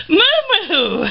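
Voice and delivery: high sing-song call of the rat's name; calling her pet. Real, not acted.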